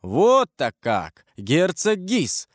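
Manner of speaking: ordinary delivery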